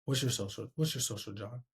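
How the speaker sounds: low, soft, fading question; meek, underpowered request; unconfident ask. Shown as a demonstration. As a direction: ask it twice, quietly trailing away. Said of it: "I sound way different saying".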